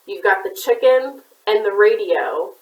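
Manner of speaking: plain reading voice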